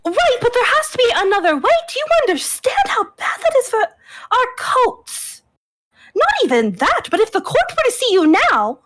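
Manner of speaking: high, fretful pleading; distressed protest. Acted. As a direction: fret at a brother, pitched high. Sister argues in distress, in character.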